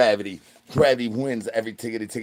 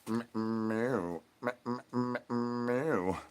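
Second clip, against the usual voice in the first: quiet imitated meows; hushed cat meows; soft meowing; 3 semitones lower, 10 dB quieter, much slower than usual. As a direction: mew softly like a cat.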